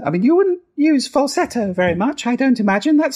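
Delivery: very high head voice; falsetto. Shown as a demonstration. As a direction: flip right up into falsetto. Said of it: "That's my falsetto voice".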